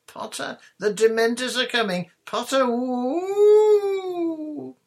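high, loud ghostly teasing; jeering screech with a drawn-out woo; taunting shriek. A narrator's reading. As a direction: shriek it, ending on a long high wail. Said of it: "shrieked Pansy Parkinson".

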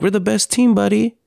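father comforting his upset son, tender; retold tender reassurance. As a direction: reassure a small child sweetly. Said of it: "I'm like".

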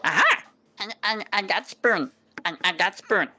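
high, stammering gleeful goblin; excited goblin. Acted. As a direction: squeak out your prize. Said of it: "the other goblin go like".

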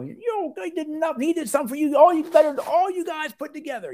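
imitated patient's high-pitched tirade; mock outrage, pitched far up, half happy and half upset; ranting and raging. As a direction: rant in a very high put-on voice. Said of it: "He was, like, ranting and raging".

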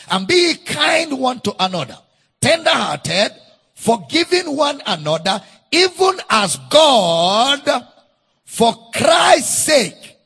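slow, rising preached verse, shouted at the peak; preached scripture reading. Acted. Preacher reading Ephesians to the congregation, booming. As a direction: proclaim the verse with growing force.

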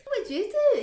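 very high, loud mock-chirpy question; mock eager greeting. Retelling meeting a well-known football guy, mock eager. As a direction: squeak the question out, hamming yourself up.

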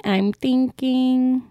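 very slow, stretched musing. Real, not acted.

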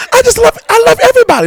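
high, sugary impression of a cheerful believer; mock bubbly voice. Pastor mocking a bubbly friend's gushing voice. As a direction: gush like the sweetest church lady. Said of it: "I don't know why I'm talking like that".

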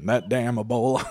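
mock the Southern accent lightly; teasing echo in a Southern accent; mimicked Southern accent.

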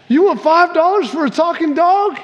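high, disbelieving squeak of a question; can't believe the bargain; incredulous. Acted. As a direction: buyer gasping at the dog's price. Buyer amazed at price.

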